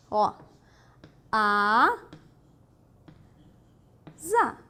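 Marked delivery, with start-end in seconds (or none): 1.3-4.6 "a" held, long pause, then "za" with voiced z; syllable breakdown. Shown as a demonstration. Teacher transcribing asa phonetically, syllable by syllable. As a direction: dictate "a, za" as two separate syllables. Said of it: "Transcrição fonética".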